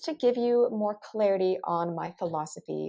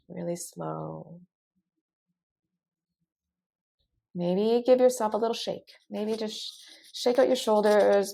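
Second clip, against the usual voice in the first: right in your ear. Acted. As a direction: calmly talk them through each breath. Leads a breathing exercise.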